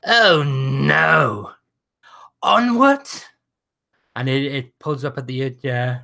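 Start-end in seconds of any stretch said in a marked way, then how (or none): 0.1-1.5 hammed-up crying no; mock wail. In character mock lament. 2.4-3.1 high, loud, grand "Onward"; grand call. Acted. In character calling next.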